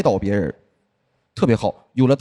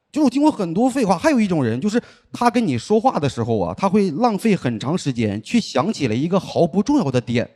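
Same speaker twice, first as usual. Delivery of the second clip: ordinary talk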